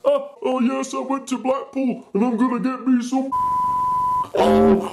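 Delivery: high-pitched parody of a noisy passenger; too-loud chatter. Acted. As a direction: babble loudly and high, hammed-up. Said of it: "conversations that are too loud".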